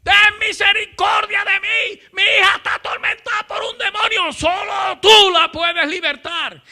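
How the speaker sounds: high, loud, desperate shouted plea; crying out after someone. Retold or imitated. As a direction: persistent woman yelling for mercy at full voice. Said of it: "dando voces detrás del Señor".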